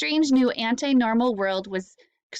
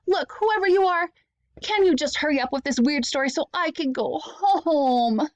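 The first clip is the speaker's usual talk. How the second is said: impatient shout; 5 semitones higher than usual. Acted. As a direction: push the narrator to hurry up. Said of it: "Evan shouted".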